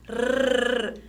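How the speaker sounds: long tongue-tip trill "Rrr"; weak R sound. Shown as a demonstration. Teacher summing up the weak sound, rolled R. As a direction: roll the R on its own. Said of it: "Som fraco".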